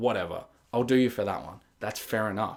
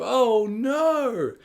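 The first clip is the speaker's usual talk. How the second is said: rising panic; 9 semitones higher than usual. Retold or imitated. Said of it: "and you think".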